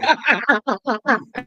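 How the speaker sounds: high-pitched laugh; high, amused cackle; teasing laugh. Real, not acted. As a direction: laugh at your own tease. Joins laughter after teasing.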